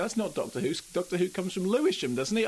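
imitating a confused viewer objecting; put-on puzzled objection; mock protest.

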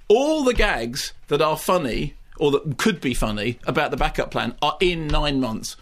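ordinary delivery

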